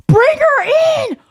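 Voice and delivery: high, loud, gleeful shout; excited shout. Retold or imitated. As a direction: yell it out wildly.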